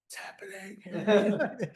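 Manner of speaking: slow, laughing what's happening; mock bafflement through laughter; bewildered laugh. Ginsberg's group facing the poets, slow laughing bewilderment. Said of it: "And they were like".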